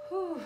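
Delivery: sharp high whew of relief; whew exhale. Real, not acted. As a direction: blow out a loud breath. Exhales before starting reading.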